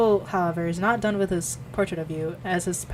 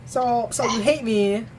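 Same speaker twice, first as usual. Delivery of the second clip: mock hurt; 3 semitones higher, slower than usual. Mock grievance at co-reader.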